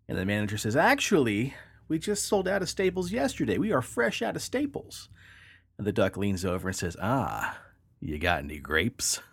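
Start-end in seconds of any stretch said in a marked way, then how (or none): 0.8-5.1 much higher, pleased shopkeeper; hammed-up self-satisfied clerk; smug manager. Joke, manager proud. 7.0-9.2 sly duck. Acted. Punchline, duck leans in.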